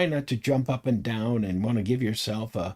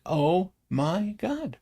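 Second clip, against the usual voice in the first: awed oh my god; 7 semitones higher, much slower than usual. Retold or imitated. Awestruck at first sight.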